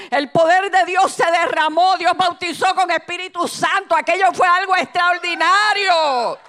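high, loud, driving testimony; full-force preaching. Real, not acted. Preacher testifies to the church about that night, full-force preaching. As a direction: build to a shouted climax of God's power.

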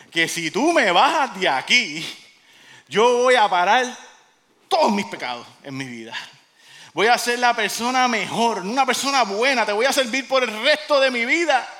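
loud, high, mounting promises; acted desperate pleading. Jack promising God a better life, pleading loudly. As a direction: bargain for rescue, building in urgency.

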